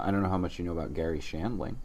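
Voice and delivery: ordinary talk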